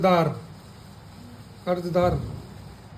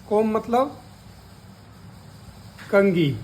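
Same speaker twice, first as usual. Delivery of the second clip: usual speaking voice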